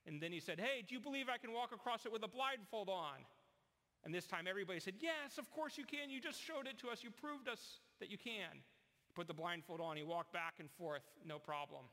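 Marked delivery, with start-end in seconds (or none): 0.6-3.2 higher, teasing showman dare; showman's challenge. Retold or imitated. Performer challenges the crowd. 5.0-8.6 high, obvious-answer crowd; confident crowd. Retold or imitated. Crowd reassures the performer.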